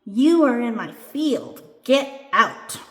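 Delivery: storybook cross little doll; angry. A narrator's reading. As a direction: order an intruder out, crossly. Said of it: "the little doll says angrily".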